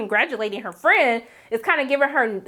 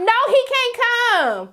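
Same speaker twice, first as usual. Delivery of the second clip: much higher, slow, drawn-out dismissive no; sassy refusal; 11 semitones higher, 3 dB louder, much slower than usual. Retold or imitated. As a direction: stretch it out like a diva shutting him down. Mock answer refusing Nathan.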